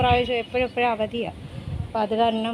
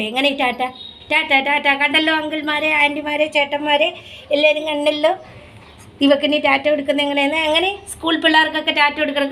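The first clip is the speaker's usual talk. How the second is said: higher, sing-song coaxing of the dog to show its trick; coaxing pet talk; 4 semitones higher than usual. Real, not acted. Owner coaxing her dog to wave for viewers, sing-song pet talk.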